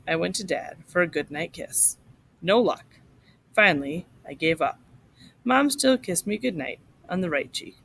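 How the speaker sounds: ordinary talk, nothing marked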